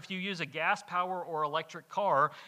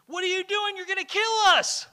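panicked shout, much higher and louder; yelling; 14 semitones higher, 10 dB louder than usual. Retold or imitated. Camping friend yelling about the bottle in the fire, panicked shout. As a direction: scream it like he's in mortal danger. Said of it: "started yelling at me".

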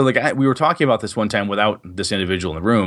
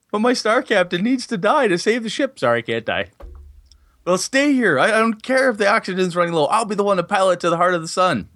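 1 heroic player voice; 9 semitones higher than usual. Pretend. Acting out a heroic player character.

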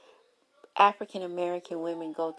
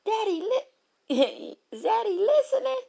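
very high, loud delighted squeal with laughter; giddy squeal; 5 dB louder than usual. Retold or imitated. Woman thrilled her man listens, high giddy squeal. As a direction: squeal like a smitten girl who can't believe it. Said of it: "You like".

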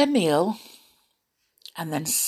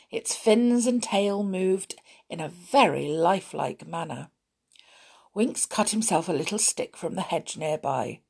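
ordinary delivery

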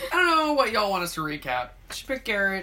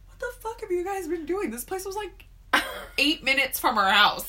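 incredulous disbelief; 8 semitones higher than usual. Retold or imitated. Retold outrage, high.

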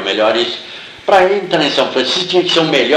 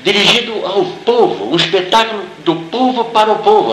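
raised pitch, emphatic retold praise; impassioned retelling; 7 semitones higher than usual. Greek actress praising a film made by the people, impassioned retelling. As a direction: declaim it as her fervent praise.

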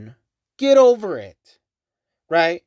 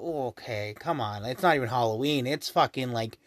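low, much softer scoffing aside; dismissive under-the-breath mock commentary; mocking mutter; 9 semitones lower, 7 dB quieter than usual. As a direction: mutter it like an unimpressed onlooker. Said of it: "we all look at them and go".

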